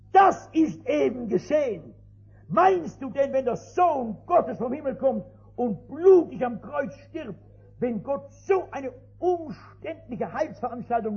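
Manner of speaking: loud, high call to rise up; fervent preaching. Acted. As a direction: preach it with full force. Missionary preaching to drunkard. (der Missionar predigt dem Trinker, laut und eindringlich)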